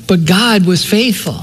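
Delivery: usual speaking voice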